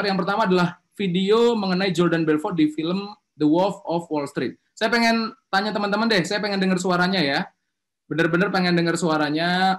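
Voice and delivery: ordinary talk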